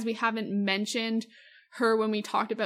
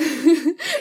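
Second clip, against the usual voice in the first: bright, louder burst of laughter; 6 semitones higher, 9 dB louder than usual. Real, not acted. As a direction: laugh out loud at yourself. Laughing at their own tangent.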